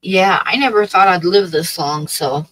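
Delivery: ordinary talk, nothing marked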